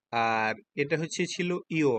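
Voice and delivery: slow spelling demo. Shown as a demonstration. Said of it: "পরেরটা দেখুন কি".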